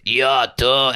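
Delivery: imitating a boy's deep new voice; a light mock of the deep-voiced kid saying "Yo, tú"; deep-voiced classmate. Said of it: "he had the deepest voice".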